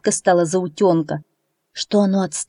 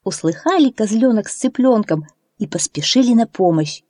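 plain reading voice

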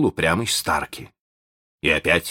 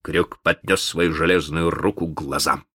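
ordinary delivery, nothing marked

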